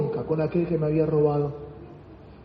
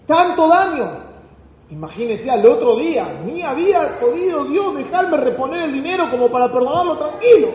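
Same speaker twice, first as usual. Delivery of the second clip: full-force preaching; 8 semitones higher than usual. Acted. Preacher stirring the church about sudden blessings, forceful. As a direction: preach it with rising fire.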